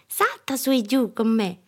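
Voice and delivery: put-on high, eager child voice; excited child at play; playful little girl. A narrator's reading. Child urging dog to play.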